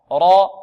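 را loud and thick; a full dark را, held; heavy raa. Shown as a demonstration. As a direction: punch out a heavy را. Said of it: "والراء مفخمة".

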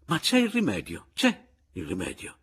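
high eager start, dropping on the repeat; hasty reassurance. A narrator's reading. Papiano hurries to advise the narrator, eagerly reassuring. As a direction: jump in with a helpful remedy. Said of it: "s'affrettò a consigliarmi Papiano".

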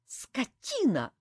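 slow, spat through teeth; a touch of hatred through clenched teeth; mild teeth-gritted hiss. A narrator's reading. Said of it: "процедила сквозь зубы Саэта". (сдержанно сквозь зубы)

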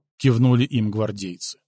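ordinary delivery, nothing marked

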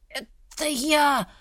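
slow, hoarse, strained voice; hoarse rasp. Acted. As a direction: rasp it out through a choked throat. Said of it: "прохрипела тень".

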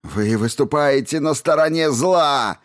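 high, anguished accusing shout. A narrator's reading. Grieving man accuses the mob of evil, shouting. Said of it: "крикнул Хусари, чьё лицо было искажено болью".

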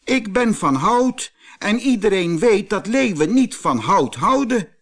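high, loud cross explanation; indignant little man. A narrator's reading. Little man lecturing the lion, high and cross. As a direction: set the lion straight. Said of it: "riep het mannetje boos".

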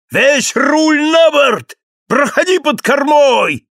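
high, loud shouted commands; shouting. A narrator's reading. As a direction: yell over the storm. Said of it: "вскричал Кэп".

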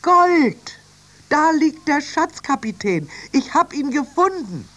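high, loud shouts of triumph; jubilant, high-pitched yelling; excited calling out. Acted. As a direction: shout the discovery up to the captain. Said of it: "rief Annie".

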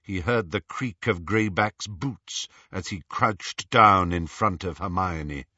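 ordinary delivery

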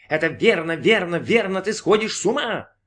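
high, sing-song squeal, rising. A narrator's reading. Voice in his head taunts Brin, high squeal. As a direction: taunt him in a high, excited voice. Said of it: "взвизгнул голос у него в голове".

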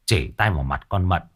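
ordinary delivery

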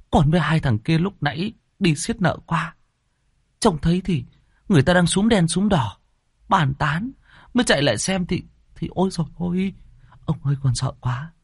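high, breathless servant boy reporting bad news; a scared lad blurting out what he saw; frightened young servant. Young servant reporting the body to his master, high and shaken.

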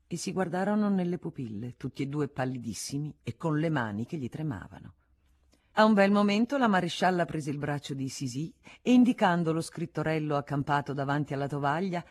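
plain reading voice

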